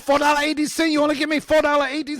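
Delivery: high, loud mock Chinese accent shouting; yelling with a Chinese accent. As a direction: yell it like the shop lady through the window, in broken Chinese-accented English. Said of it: "She was going".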